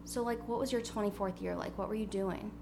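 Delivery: usual speaking voice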